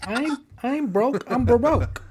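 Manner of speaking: high silly put-on voice. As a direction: squeak the pun out high. Joke catchphrase in put-on voice.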